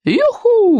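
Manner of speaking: high-pitched, drawn-out cheer; exultant girlish cry; excited whoop. A narrator's reading. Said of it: "выкрикнула Камикадзе".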